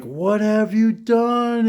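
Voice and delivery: high, slow mock shriek of a mother; imitated mom yelling. Mom catching her drunk son in the driveway, mock yell. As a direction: play the horrified mom, stretched out. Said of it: "she was yelling at me".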